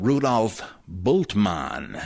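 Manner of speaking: "Bultmann" as BOOLT-mahn, slowly; long u and open a in "Bultmann"; German pronunciation. Shown as a demonstration. Introducing a German theologian, "Bultmann" as BOOLT-mahn. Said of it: "The U is long".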